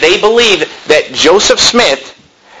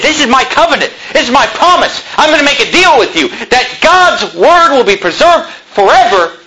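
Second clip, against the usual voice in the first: much higher, ringing promise with a laugh of delight; God's covenant, preached; 9 semitones higher than usual. Acted. Pastor voicing God's covenant to the church, high and ringing. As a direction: declare it like a solemn deal. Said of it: "When God said".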